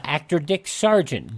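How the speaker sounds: ordinary talk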